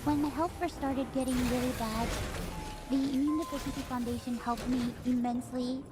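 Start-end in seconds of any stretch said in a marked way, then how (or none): none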